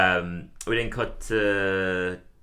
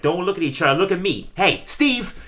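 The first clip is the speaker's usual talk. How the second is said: higher and louder, barking at the rats; mock scolding yell; 9 semitones higher, 5 dB louder than usual. Moe scolding his rats for the chalk dust, hammed-up yell. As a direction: tell off a room of pests, ending in a laugh.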